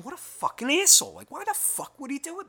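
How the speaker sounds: high-pitched, incredulous griping; retold indignation. Owner judging a neighbor from afar, indignant.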